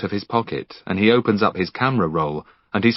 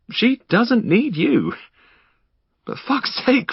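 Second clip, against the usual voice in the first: slow, much higher, pressing the point; anguished plea; 12 semitones higher, slower than usual. Acted. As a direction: land every word, then break into exasperation. Henry pleading about Finn's daughter.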